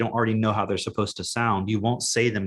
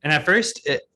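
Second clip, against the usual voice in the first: ordinary delivery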